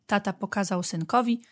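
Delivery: plain reading voice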